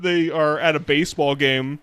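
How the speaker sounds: ordinary delivery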